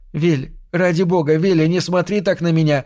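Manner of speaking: выше, с мольбой и отчаянием; вскрикнуть, не выдержав взгляда; отчаянное восклицание. A narrator's reading. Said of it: "Хейди воскликнула". (жена умоляет мужа не смотреть так, отчаянно)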